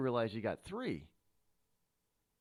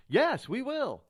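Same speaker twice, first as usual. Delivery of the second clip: high, louder, lightly voiced official; eager official; 10 semitones higher, 5 dB louder, slower than usual. College official to a transfer student, eager. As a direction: play an official keen on the money.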